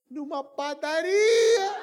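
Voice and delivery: high, loud, stretched-out wail; mock crying. Pastor tearfully announcing the missionary's death, mock wail. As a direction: sob it out in hammed-up grief.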